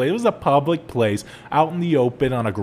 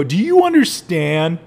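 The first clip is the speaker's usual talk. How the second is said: exasperated retort; 3 semitones higher, 5 dB louder than usual. Retold or imitated. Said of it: "I go".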